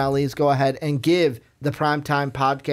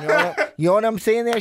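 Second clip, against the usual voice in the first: ordinary delivery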